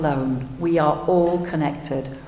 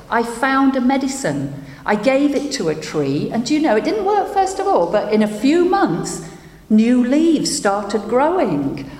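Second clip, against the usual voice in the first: a lightly voiced doctor, higher, pouring out his failure; despairing doctor; 5 semitones higher than usual. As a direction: tell the tree how you failed, rising higher as it gets worse. Doctor's long lament.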